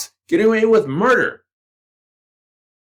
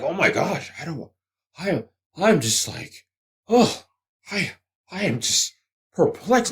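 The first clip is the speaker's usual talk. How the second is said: mock bewilderment; 3 semitones lower, 3 dB quieter than usual. Mocking clueless employers.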